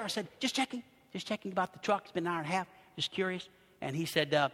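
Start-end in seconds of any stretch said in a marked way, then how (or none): 0.4-3.5 quiet polite nudge; tentative soft inquiry. Retold or imitated. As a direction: check in softly, not wanting to bother. Politely asking the mechanic about truck.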